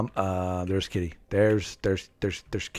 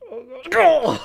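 laughing yelp; 11 semitones higher, 3 dB louder than usual. Real, not acted. Shocked by his own penalty.

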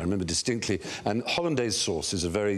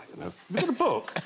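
airy tease; 13 semitones higher, faster than usual. Retold or imitated. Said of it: "and then you just think".

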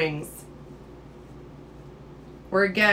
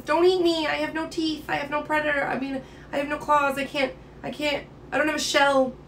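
higher, plaintive little creature pleading; unhappy sea hare; 5 semitones higher than usual. Acted. Defenseless sea hare to a predator, unhappy pleading. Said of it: "if he was unhappy and says".

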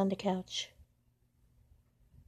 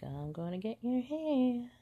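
slow cat voice; 6 semitones higher, 5 dB quieter than usual. Pretend.

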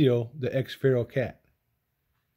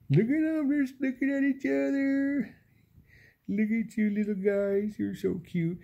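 much higher, sweet cooing at the cats; 10 semitones higher than usual. Real, not acted. Owner coos at two cuddling cats in high baby talk. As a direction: coo at them like babies.

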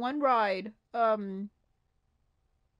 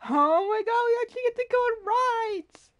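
high, loud excited squeal; thrilled, high-pitched outburst; freaking out; 10 semitones higher, 6 dB louder than usual. Real, not acted. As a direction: gush loudly in rising excitement. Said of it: "I'm just gonna be freaking out".

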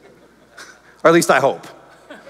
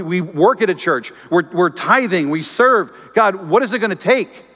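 higher, pleading cry to God; anguished prayer; 7 semitones higher, 3 dB louder than usual. Retold or imitated. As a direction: plead with God, desperate and raw.

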